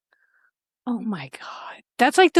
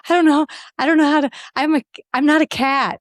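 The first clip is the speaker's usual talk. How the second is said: mock panic; 6 semitones higher, faster than usual. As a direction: play the panicked lawyer stuck behind the cat face. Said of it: "His eyes were sheer panic".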